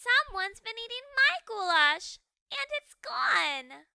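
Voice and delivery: high, loud whiny little ghost; baby ghost. Acted. Baby ghost finds empty bowl, high loud whine.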